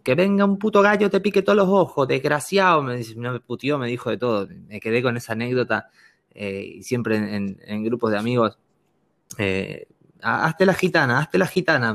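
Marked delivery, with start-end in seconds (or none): 0.0-2.8 high, shrieking mock curse; furious street curse, half-mocking. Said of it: "me maldijo". 10.2-11.9 high teasing chant; mock-chanting friends; friends egging on. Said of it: "me decían".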